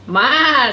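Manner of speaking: high, loud bleat; goat bleat. A narrator's reading. Goat says hello to Blue, loud bleat. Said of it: "said the goat".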